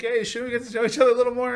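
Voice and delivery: mock prim hesitation; flustered Bond imitation. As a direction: rush it out like a man caught off guard. Imitating Bond's flustered protest.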